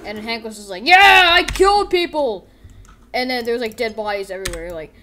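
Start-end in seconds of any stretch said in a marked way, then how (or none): 0.8-2.5 loud, high, slow triumphant yell; gloating yell. Acted. Hank gloating loudly over the killing. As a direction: bellow it proudly.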